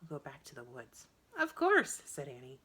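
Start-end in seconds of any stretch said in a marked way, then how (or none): 0.0-1.1 softer, lower quiet promise; softly. A narrator's reading. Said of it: "Jack said softly". 1.4-2.0 high, loud eager agreement; cheery. A narrator's reading. Cheerful repeated replies.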